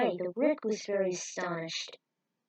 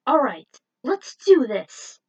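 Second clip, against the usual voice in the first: psyched up; 4 semitones higher, 10 dB louder, slower than usual. Acted. Rick psyching up.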